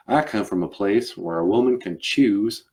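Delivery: earnest Southern gentleman endorsing a cause; Southern accent. Acted. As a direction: proclaim it firmly in a Southern accent. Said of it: "And he was like".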